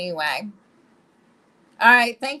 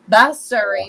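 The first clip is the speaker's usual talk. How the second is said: loud dismissal; 11 dB louder than usual. Real, not acted. Host shooing away a voice assistant, loud. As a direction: call it out to dismiss her.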